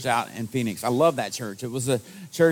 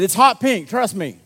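loud, high mock insistence; imitating an alarmed caller, pitched up; retold exasperation; 6 semitones higher, 8 dB louder than usual. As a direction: insist loudly, as an exasperated elder. Retold elder insisting on the color.

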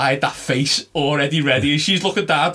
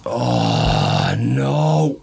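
acting distraught; 4 semitones lower than usual. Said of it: "acting proper distraught".

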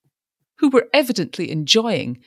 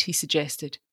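usual speaking voice

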